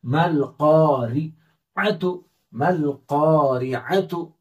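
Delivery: careful teacher's read of मल क़ारिअतु; slow Quranic reading. Shown as a demonstration. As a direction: recite मल क़ारिअतु slowly, मीम joined onto लाम. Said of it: "रा को बारीक पढ़ेंगे".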